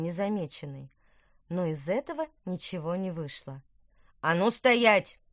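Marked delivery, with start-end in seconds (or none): 4.2-5.2 slow, loud menacing command; threatening shout. Acted. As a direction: bark it out to freeze him. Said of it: "крикнул Тыквер таким угрожающим тоном". (грозный окрик)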